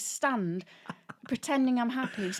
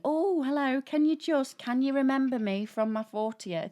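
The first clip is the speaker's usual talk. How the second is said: higher, louder gushing greeting at the start; overeager acquaintance; 5 semitones higher, 4 dB louder than usual. Retold or imitated. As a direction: mimic an overeager person stopping someone. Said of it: "and then somebody".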